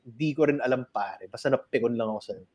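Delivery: low, soft grumbling curse; grumpy stoned guy. Retold or imitated. A hint of an annoyed stoner cursing the comic for refusing the joint. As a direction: mutter it like a sulky stoned man. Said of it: "nung galit".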